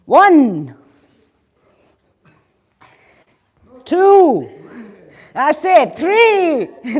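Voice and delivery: loud, punchy fitness count; coach counting reps. Acted. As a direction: call the reps out to the class. Leads the class counting reps.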